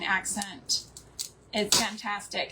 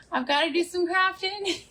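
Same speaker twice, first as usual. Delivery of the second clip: imitating daughter; 6 semitones higher than usual. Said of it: "she was like".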